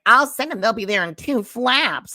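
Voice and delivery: mock owl voice. Mocking the owl's reply, high and loud. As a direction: parody a smug owl promising help.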